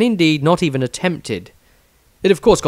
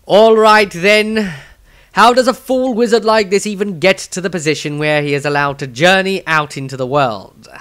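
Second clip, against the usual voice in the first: impatient councillor; 6 semitones higher, 6 dB louder than usual. Acted. Artweeb butts in to challenge Viez, loud and impatient. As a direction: demand an answer.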